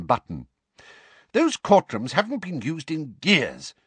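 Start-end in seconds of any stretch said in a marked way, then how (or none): 1.3-3.7 angry. A narrator's reading. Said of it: "said Mister Weasley angrily".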